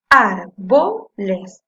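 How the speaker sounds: "Árboles" loud and very slow, in three chunks; syllable by syllable. Shown as a demonstration. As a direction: punch out "Ár", "bo", "les" separately. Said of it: "Voy a separar en sílabas".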